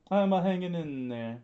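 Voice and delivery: voiced Chopper. Chopper quoted in story.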